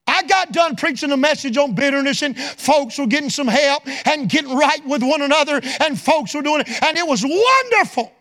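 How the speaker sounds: loud, high revival preaching; preaching at full force. Acted. Preacher to congregation, loud revival preaching. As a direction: build it up and shout it to the church.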